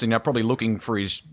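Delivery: ordinary talk, nothing marked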